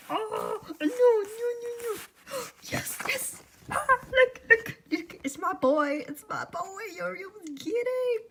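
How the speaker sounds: very high, breathless fangirl squeals; overwhelmed squealing. Real, not acted. Seeing her favourite boy in print, overwhelmed squealing. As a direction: squeal in delighted disbelief.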